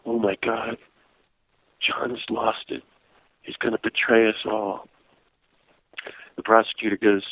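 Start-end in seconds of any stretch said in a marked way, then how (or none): 0.1-4.9 soft, low-volume mock aside from the back row; hushed dismay. Longtime activist in the back of silent courtroom, hushed dismay. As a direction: murmur it in hushed disbelief, imitating onlookers.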